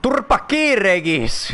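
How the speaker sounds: slow, high, loud telling-off; real furious yell; angry shout. Real, not acted. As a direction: yell at the companion to shut up. Yelling at Regis.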